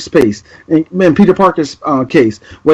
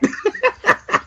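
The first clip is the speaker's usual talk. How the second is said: cackle; 8 dB quieter than usual. Retold or imitated. After the Krusty impression.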